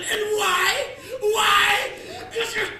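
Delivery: high, loud hammed-up yelling; mock shouting. Thug screaming at the victim, loud mock yell. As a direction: yell it like a madman.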